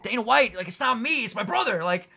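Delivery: lightly acted plea to be taken back; pleading. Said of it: "And he even said".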